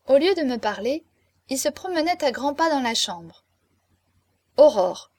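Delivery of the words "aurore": changed voice. A narrator's reading. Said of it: "d'une voix changée".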